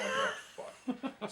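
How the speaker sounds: a very high, laughing squeak of dismay. Retold or imitated. After smashing the old mirror, a high laughing squeak of dismay. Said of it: "I'm like".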